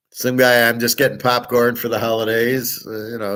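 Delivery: usual speaking voice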